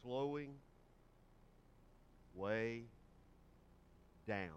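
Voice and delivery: drawn out, long pauses between words; slowed right down. Shown as a demonstration. Preacher showing the congregation slow emphasis, drawn out. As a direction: stretch each word with long pauses. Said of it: "possible to emphasize things by".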